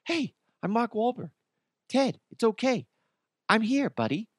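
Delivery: high, breathy mock Wahlberg voice; Mark Wahlberg impression. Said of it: "practice my Mark Wahlberg".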